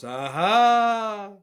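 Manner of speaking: high, drawn-out, rising triumphant aha with an Eastern European lilt. Acted. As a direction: gloat in a slightly Eastern European accent, voice climbing high. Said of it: "inflection on your ahas".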